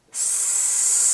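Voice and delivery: long hissing s on the exhale; drawn-out s like a snake. Shown as a demonstration. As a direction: hiss the breath out slowly. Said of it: "On the exhale".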